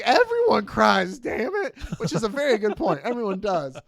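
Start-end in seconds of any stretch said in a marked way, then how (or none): 0.0-1.7 loud, high, drawn-out protest; mock tearful outburst. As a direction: shout it back in hammed-up indignation. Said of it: "Are you crying?".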